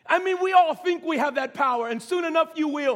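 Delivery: high, loud preacher's delivery. Real, not acted. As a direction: lift it up over the congregation.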